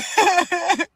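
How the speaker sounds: short laugh through tears; teary laugh. Real, not acted. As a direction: laugh it off while crying.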